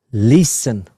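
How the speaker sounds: usual speaking voice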